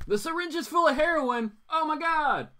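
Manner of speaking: high, loud mock gasp; mock shock. Host reading a viewer comment, mock-shocked. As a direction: read it as a scandal.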